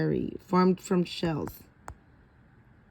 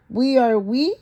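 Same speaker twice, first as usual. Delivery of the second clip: plain reading voice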